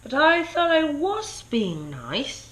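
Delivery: hurt King Pig. Acted. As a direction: protest that you tried, wounded. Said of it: "said King Pig".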